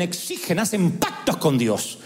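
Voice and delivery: ordinary talk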